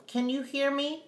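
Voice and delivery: lightly voiced African accent; a hint of an African accent, falling hellos; greeting in a mild African accent. A narrator's reading. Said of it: "with his proper African accent".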